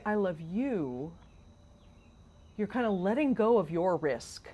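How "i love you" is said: "you" stretched and lifted at the end of slow "I love you"; stress on you. Shown as a demonstration. Saying it to a person who has feelings, stress on "you". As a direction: draw out "you", rising. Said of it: "when you say".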